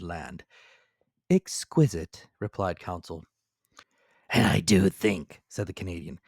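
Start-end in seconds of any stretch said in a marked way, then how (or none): 1.3-2.3 Counsel's voice. A narrator's reading. 4.3-5.4 Ned Land's voice. A narrator's reading. Ned Land voiced in story.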